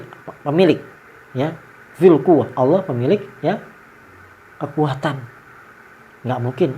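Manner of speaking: ordinary talk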